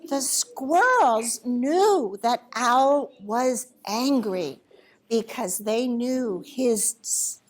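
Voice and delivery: plain reading voice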